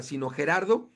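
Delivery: ordinary talk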